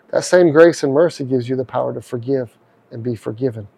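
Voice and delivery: usual speaking voice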